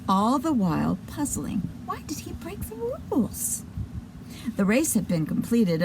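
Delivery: ordinary delivery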